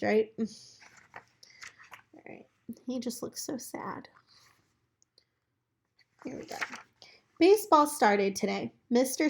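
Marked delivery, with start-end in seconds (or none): 2.9-4.1 soft unvoiced whisper about the picture; whispered aside. Real, not acted. Aside while reading picture book. 6.2-6.6 hushed whisper to begin. Real, not acted. Starting to read aloud.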